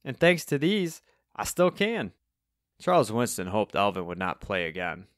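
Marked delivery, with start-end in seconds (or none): 0.0-2.1 high-pitched proud character voice; proud old Cockney bassist; proud announcement. A narrator's reading. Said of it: "proudly announced".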